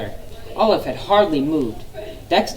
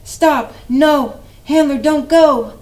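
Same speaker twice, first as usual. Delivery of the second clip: high, loud panicked cry; urgent shout; 10 semitones higher, 6 dB louder, slower than usual. Acted. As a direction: stop the car at the cliff's edge. Olive stops the car.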